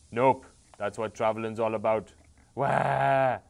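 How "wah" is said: loud cartoon wail; hammed-up cartoon sob; mock crying wail. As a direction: fake a bawling baby. King character bursts out crying.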